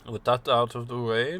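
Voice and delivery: normal talking voice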